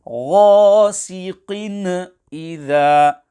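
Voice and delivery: غَاسِقٍ إِذَا with a wrong bouncing release inside غَاسِقٍ; incorrect qalqalah. Shown as a demonstration. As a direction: recite it high and loud, adding a stray echo to the end of غَاسِقٍ. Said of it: "with incorrect qalqalah".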